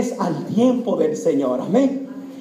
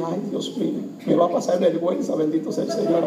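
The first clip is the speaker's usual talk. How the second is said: low, soft muttered prayer; hushed, worried inner thought; dreading aside; 5 semitones lower, 5 dB quieter than usual. Retold or imitated. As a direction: mutter it to yourself, bracing for embarrassment. Son's dreading private thought retold.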